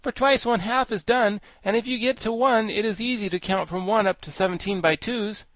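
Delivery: high-pitched, projected character voice; high, emphatic storybook know-it-all; Wogglebug, pedantic explainer. A narrator's reading. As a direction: explain it like a lecturing insect.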